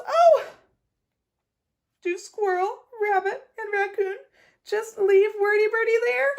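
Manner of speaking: very high, frightened crying plea; worried plea. Acted. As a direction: beg in a scared high voice. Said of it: "She says".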